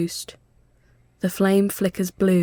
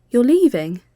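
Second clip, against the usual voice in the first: alarmed; 5 semitones higher, 7 dB louder than usual. A narrator's reading. Alarmed question.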